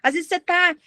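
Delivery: usual speaking voice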